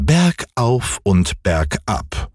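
usual speaking voice

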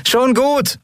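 very high-pitched holler. A narrator's reading. Endriel answering over engines, high insistent call. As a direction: pitch it right up over the din. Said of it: "rief sie noch lauter".

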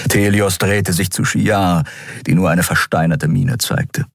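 ordinary delivery

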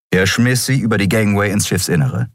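ordinary delivery, nothing marked

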